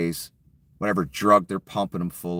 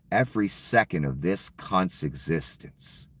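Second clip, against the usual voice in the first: usual speaking voice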